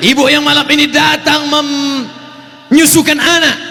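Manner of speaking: impassioned preacher's call; rousing oratory. Real, not acted. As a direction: cry it out to the whole crowd. Rousing address to mothers in crowd.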